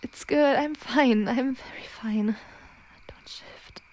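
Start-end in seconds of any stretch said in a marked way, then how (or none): 3.1-3.8 fading into a very soft whisper; whispered self-control. Acted. Werewolf insists all is fine while fighting the shift, whispered self-control. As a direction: breathe it out, almost without voice.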